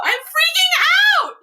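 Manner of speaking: high, loud, drawn-out squeal; real ecstatic outburst; freaking out. Real, not acted. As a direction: shriek it slowly, overcome with excitement. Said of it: "I'm, like, freaking out".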